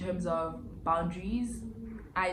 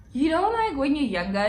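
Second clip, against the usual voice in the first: excited rant; 8 semitones higher, 8 dB louder than usual. Real, not acted.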